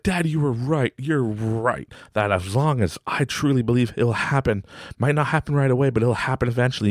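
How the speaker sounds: put-on happy child. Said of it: "so happy".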